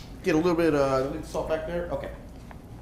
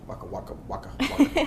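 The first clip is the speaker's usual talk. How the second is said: Pac-Man waka sound; 10 semitones higher than usual. Retold or imitated. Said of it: "these little Pac-Mans".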